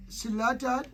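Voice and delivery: normal talking voice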